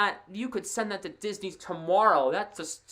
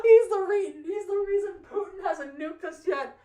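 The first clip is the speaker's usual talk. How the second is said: real high-pitched laughing fit, nearly in tears; crying with laughter; 12 semitones higher, 4 dB louder than usual. Real, not acted. Commentator cracking up at his own team's goal, crying with laughter. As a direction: squeal the words out through tearful laughter. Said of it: "I'm crying of laughter".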